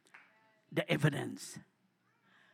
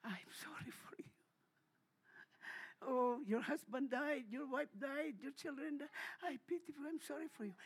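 soft, hushed mock sympathy; pitying whisper; 3 semitones higher, 16 dB quieter than usual. Preacher mocks the wrong meaning of pity, soft and pitying. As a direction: murmur it like false pity. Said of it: "That word pity means not that".

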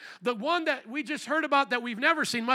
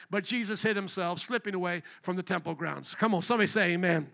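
ordinary talk

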